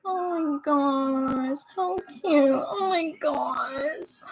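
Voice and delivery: real choked-up hush; teary whisper. Real, not acted. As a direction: whisper through tears of awe. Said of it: "no tears are flowing, but I keep, like, tearing up".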